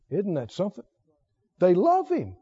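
ordinary talk, nothing marked

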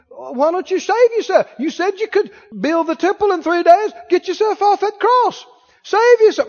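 high-pitched heckling from a hostile crowd; mocking, jeering, taunting. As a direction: goad him with rising, high jeers. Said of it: "Mocking him, jeering him, taunting him".

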